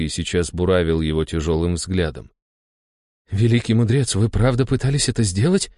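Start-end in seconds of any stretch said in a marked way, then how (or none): 3.3-5.7 higher, astonished question; amazed. A narrator's reading. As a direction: ask in disbelief. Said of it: "изумлённо проговорил Иван".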